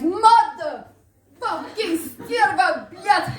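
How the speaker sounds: loud, high explosion of fan rage; sweary outburst. Acted. Hockey fan swearing at the game mid-conversation, explosive shout. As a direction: erupt into slow, shouted swearing.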